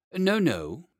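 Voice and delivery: high, emphatic protest; shocked denial. A narrator's reading. Gentlemen shocked at the boy's question, high emphatic denial. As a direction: protest high and loud, scandalised. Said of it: "both the gentlemen were sincerely shocked".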